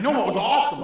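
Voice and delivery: excited loud classroom outburst. Retold or imitated. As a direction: blurt it out too loud.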